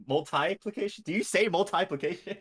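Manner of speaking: "multiplication" as mul-tie-plication; multi as MUL-tie. Shown as a demonstration. Repeats the unusual pronunciation.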